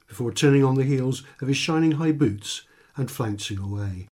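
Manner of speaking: ordinary delivery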